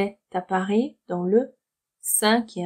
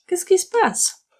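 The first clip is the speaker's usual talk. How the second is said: dialogue line; 10 semitones higher than usual. Acted. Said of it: "un petit dialogue".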